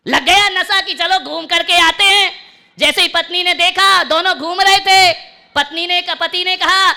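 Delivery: high, loud preacher's narration; full-force storytelling. Acted. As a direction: tell it at full volume to the hall. Preacher belting out an anecdote.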